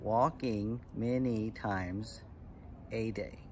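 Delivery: very slow, syllable by syllable; measured haiku recital. Shown as a demonstration. Teacher reciting an example haiku to kids, slow and measured. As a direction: draw out each word for counting.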